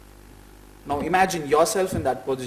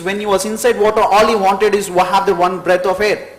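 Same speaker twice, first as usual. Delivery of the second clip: rising, louder motivational push, higher pitch; passionate speech; 3 semitones higher, 4 dB louder than usual. Real, not acted. Speaker urges aspirants to want success like breath, passionately. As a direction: drive the point home with mounting force.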